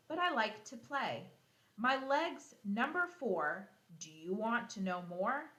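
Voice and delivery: put-on a lively read-aloud riddle for young kids. Teacher reading a lion riddle to her class, playful read-aloud.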